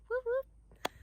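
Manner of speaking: playful cheer. Real, not acted. Cheering daughter's permit.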